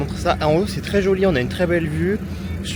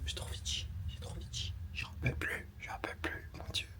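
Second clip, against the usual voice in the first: desperate hushed whisper, softer; urgent whisper; 23 dB quieter than usual. Real, not acted. Waiting for the bathroom, she whispers desperately. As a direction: whisper it, bursting to go.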